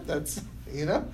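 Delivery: ordinary talk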